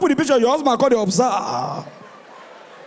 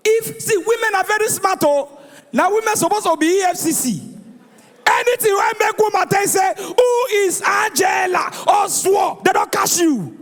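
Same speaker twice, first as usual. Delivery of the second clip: raised, higher-pitched rant; ranting shout; 8 semitones higher than usual. Real, not acted. Comedian warning men about smart wives, ranting shout. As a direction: shout it out to the crowd.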